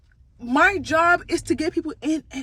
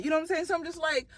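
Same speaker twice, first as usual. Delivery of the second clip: usual speaking voice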